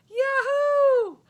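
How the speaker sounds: very high, loud cheer; joyful whoop. Acted. Boy cheers about Uncle Joe's present. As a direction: whoop with delight.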